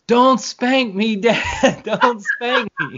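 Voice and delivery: high, drawn-out mock yell; retold child's yell. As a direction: imitate a kid yelling out in church, half laughing. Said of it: "she yells at the top of her lungs".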